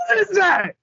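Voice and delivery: high-pitched bewildered outburst; baffled shout. Real, not acted. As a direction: shout it out in total confusion. Baffled younger host reacts loudly.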